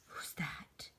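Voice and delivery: a hushed, unvoiced question into the dark; nervous whisper. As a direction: whisper it, afraid of the answer.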